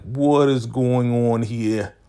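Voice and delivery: loud, high, drawn-out mock outrage; slow, booming acted question; imitated stern demand. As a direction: stretch it out like someone bursting in on trouble. Acts Daikon walking in on quarrel.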